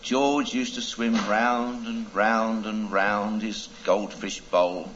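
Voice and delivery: ordinary talk, nothing marked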